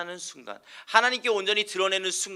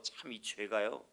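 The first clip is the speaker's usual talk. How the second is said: ordinary talk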